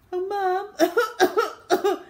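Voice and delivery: high, loud pitiful call; feigned sickly whine. Acted. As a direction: call out as a poorly child. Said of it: "added Henry, coughing".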